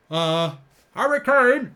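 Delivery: slow, high, strained Harry Kane voice; Harry Kane impression. Attempting his Harry Kane impression for the stream. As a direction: stretch out his name in a put-on voice. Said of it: "I used to be able to do Harry Kane".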